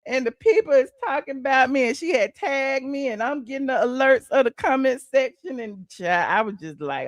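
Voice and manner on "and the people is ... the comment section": normal talking voice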